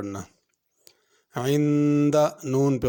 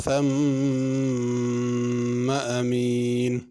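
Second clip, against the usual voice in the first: slow, drawn-out chanted recitation; measured melodic tilawat; Quran recitation; much slower than usual. Shown as a demonstration. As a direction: recite it like the teacher modelling tajweed. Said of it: "teacher recites verse to model tajweed".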